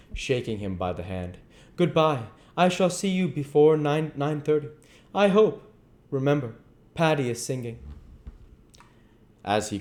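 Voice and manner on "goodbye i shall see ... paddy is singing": raised, lively character voices; character dialogue. A narrator's reading. Reader voicing Henry and Dorian in high dialogue.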